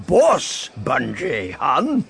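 high, slow, amused big boss; merry giant boss. A narrator's reading. Giant boss correcting an old acquaintance, merry. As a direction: correct him with drawn-out, high-pitched relish. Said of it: "guffawed in merriment".

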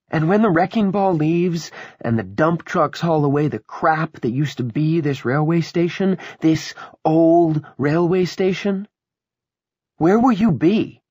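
high, loud, scornful challenge; taunting woman. Acted. Willa to Henry about the coming demolition, taunting. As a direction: lecture him loudly, pitched well up, with mounting scorn.

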